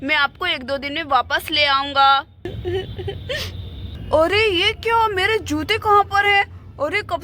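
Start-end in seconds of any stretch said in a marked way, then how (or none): 2.4-4.1 soft crying wail. Acted. Crying as bride leaves.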